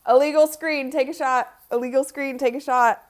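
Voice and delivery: loud, high call of illegal screen; shouted call. Real, not acted. Fan yelling a foul call at the TV, loud. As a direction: yell it at the refs. Calling a foul during game.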